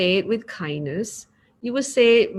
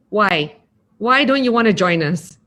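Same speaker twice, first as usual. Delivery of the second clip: louder, pushy demand; demanding, unpleasant; 6 dB louder than usual. Shown as a demonstration. Teacher showing a disrespectful reply to a refusal, loud and pushy. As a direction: press them hard for a reason. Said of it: "Does that sound pleasant?".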